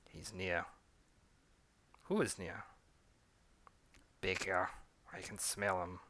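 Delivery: put-on quiet, tense exchange in accents; hushed accents. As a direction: murmur it low, sensing danger. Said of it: "a British and French accent".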